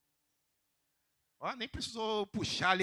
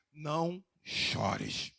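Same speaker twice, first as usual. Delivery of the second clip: slow tender comfort; 7 semitones lower, 6 dB quieter, much slower than usual. Acted. Jesus comforting the widow.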